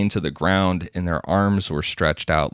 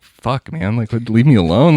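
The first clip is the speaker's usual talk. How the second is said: annoyed whine; 6 semitones higher, 8 dB louder than usual. Retold or imitated. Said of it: "Joseph being like".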